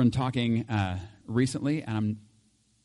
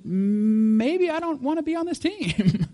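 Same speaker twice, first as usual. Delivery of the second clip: pondering question; 11 semitones higher, slower than usual. Retold or imitated. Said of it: "you have to ask the question".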